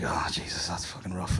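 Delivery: low, soft stunned whisper; hushed aside. Retold or imitated. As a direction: whisper it into the silence. Sitting in stunned silence at hospital.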